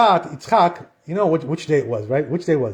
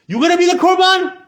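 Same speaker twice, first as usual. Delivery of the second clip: mocking shout; 12 semitones higher, 6 dB louder than usual. Satan mocks Yitzchak in retold midrash.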